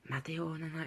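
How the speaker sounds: high-pitched imitation of a teacher calling out; put-on high teacher's cry; mock scolding yell. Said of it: "Il commence à me crier dessus".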